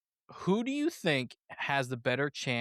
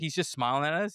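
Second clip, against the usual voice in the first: normal talking voice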